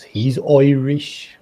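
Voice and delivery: drawn-out "Irish" said as "OY-rish", mock Irish; mock Irish accent. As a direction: stretch "Irish" slowly into an Irish "Oirish". Playfully repeats Irish as Oirish.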